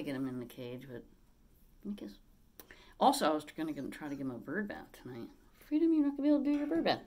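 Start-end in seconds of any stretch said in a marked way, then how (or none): 1.8-2.2 whispered. Real, not acted. Aside to parrot. 5.7-7.0 high, louder, fast teasing to the bird; telling her parrot about the bird bath in a high pet voice. Real, not acted. Talking to parrot.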